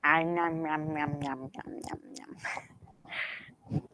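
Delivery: soft chewing nom noises; nom-nom fading to a whisper; nom nom sounds. Shown as a demonstration. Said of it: "This is how I make voices".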